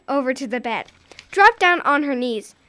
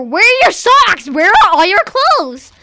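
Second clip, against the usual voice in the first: loud, high, slowing demand; angry yell; 7 semitones higher, 11 dB louder than usual. Acted. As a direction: shout at the child, fed up. Mother's anger peaks.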